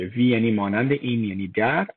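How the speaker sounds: ordinary talk, nothing marked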